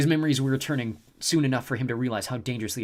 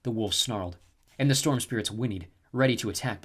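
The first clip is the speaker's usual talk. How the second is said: ordinary delivery, nothing marked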